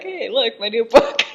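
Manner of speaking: high-pitched, amused mock boast; laughing imitation. Marquis proudly showing his book, voiced laughingly. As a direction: say it on the edge of cracking up.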